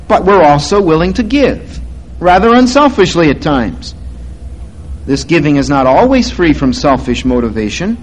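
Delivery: ordinary talk, nothing marked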